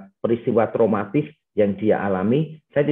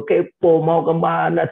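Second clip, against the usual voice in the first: normal talking voice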